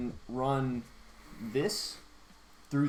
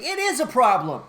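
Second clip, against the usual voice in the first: loud sharp customer retort; angry retort; 8 semitones higher, 8 dB louder than usual. Retold or imitated. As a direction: snap it back angrily.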